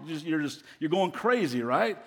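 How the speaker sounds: normal talking voice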